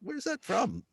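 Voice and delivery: high, hushed bafflement; puzzled retort. Retold or imitated. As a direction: ask it softly in a high, incredulous pitch. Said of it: "you're listening to him, you're going".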